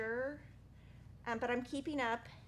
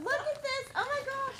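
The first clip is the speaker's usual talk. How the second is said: high, thrilled oh my gosh; excited gush; 13 semitones higher, 3 dB louder than usual. Real, not acted. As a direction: gush over the quilt. Shows off friend's quilt.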